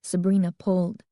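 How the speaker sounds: ordinary delivery, nothing marked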